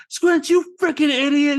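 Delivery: put-on high, loud kid's angry yell; kids yelling. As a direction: shriek it at your dumb friend like a furious kid.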